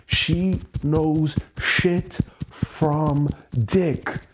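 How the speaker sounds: very slow, each word spelled out; slow, deliberate scorn; slow emphatic. Real, not acted. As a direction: lean on every word scornfully. Venting about girlfriend.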